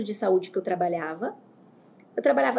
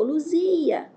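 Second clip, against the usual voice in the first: mild retold yell, higher pitched; drawn-out, higher child's cry for someone; imitating toddler's call; 6 semitones higher than usual. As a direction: call out like a little girl wanting someone. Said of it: "a Ana Júlia gritou".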